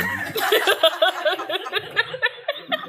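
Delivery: high rising cackle; high giggle; high laugh. Real, not acted. Laughs at mama quote.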